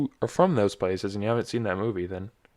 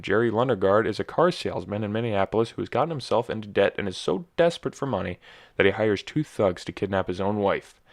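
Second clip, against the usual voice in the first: usual speaking voice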